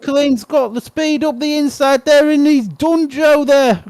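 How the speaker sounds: high-pitched, excited play-by-play; live race commentary. Real, not acted. Speedway commentator following riders on the inside, excited live commentary. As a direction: call the race as it unfolds.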